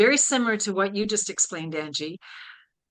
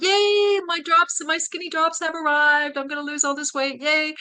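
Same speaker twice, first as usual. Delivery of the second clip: mock giddy post; 7 semitones higher than usual. Quoting her own silly Facebook post, mock giddy glee. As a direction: play up silly self-mocking excitement. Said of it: "I said something stupid like".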